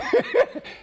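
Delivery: real laugh after the punchline; burst of laughter. Real, not acted. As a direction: crack up at the memory. Laughing at coach's put-down.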